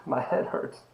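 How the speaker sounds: soft, half-whispered mock moaning with a laugh; imitating himself in pain, hushed and breaking into a laugh; retold headache misery. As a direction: ham up a pounding headache, barely voiced. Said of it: "And I'm about in tears".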